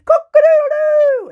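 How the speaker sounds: very high, loud held crow; rooster crow. A narrator's reading. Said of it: "And the rooster crowed".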